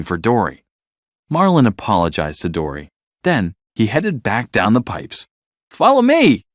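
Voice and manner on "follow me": high, louder call ahead; calling out to lead the way. A narrator's reading. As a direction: call back to a friend down the pipe. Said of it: "he called".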